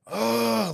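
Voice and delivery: loud, high groan of frustration; acted exasperated "ugh"; frustrated groan. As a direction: groan loudly, imitating someone fed up. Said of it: "he started to get frustrated".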